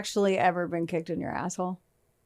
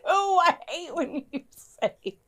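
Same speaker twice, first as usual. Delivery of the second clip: laughing; 10 semitones higher, much slower than usual. Real, not acted. Friend says crude phrase.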